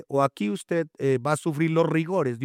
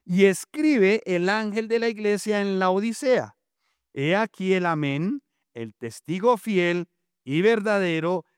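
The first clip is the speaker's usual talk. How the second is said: raised, declaiming scripture reading; Bible reading; 4 semitones higher than usual. Shown as a demonstration. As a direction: read the verse out to the congregation. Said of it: "Vuelvo a repetir". (lectura bíblica declamada)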